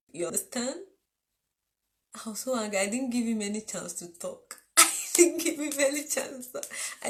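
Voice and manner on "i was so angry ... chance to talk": ordinary delivery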